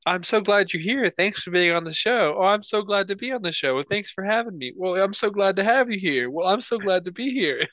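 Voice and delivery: high, bright, overly polite host chatter; mock host greeting. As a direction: parody the stiff AI hosts greeting each other. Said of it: "It's like five minutes of".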